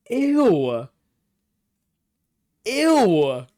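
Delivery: loud, high drawn-out "ew"; long squeal of revulsion; grossed out. Real, not acted. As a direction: recoil in real disgust, pitch way up. Reacting to a horrible glitched sound.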